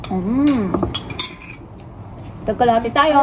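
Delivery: speaking between bites; talking while chewing. Real, not acted. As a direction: food in mouth. Seen 0: Left speaker holds plate, lips working between words, eating throughout.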